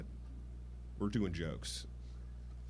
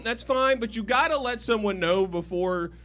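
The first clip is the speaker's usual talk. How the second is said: ordinary talk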